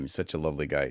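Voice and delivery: normal talking voice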